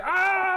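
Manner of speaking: sharp high pained yelp; scream of agony. Retold or imitated. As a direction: cry out as if in sudden pain. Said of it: "the screams of agony".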